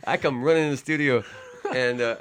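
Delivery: high, laughing and breathless. Real, not acted. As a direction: tell it through laughter. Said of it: "I was all out of breath".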